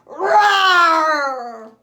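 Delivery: loud roaring engine sound; bulldozer roar. Acted. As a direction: roar like a huge machine.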